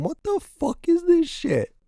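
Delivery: high squeaky disbelief; a high, squeaky what-the-hell; shocked squeal. Real, not acted. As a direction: squeal it out in horror. Shocked at an ugly car.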